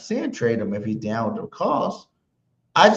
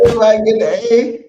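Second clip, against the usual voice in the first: high, loud, quick shout; hyped-up proclamation; 9 semitones higher, 10 dB louder, faster than usual. Acted. Cohost hyping the grades for everybody, high excited shout. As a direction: shout it high like handing out prizes to a crowd.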